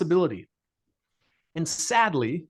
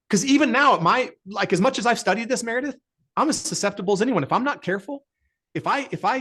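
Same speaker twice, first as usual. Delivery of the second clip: emphatic; 8 semitones higher than usual. Real, not acted. Emphatic personal admission.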